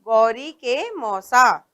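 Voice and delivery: slow, word by word, au sounds clear; slow careful reading. Shown as a demonstration. Teacher reading story sentence to class, slow and clear. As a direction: read it slowly for the children. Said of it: "ये कहानी पढ़ते हैं".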